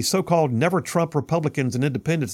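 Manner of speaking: usual speaking voice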